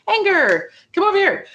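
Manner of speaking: high, bossy calling out; summoning anger. Pretend. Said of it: "hmm, I don't like feeling sad".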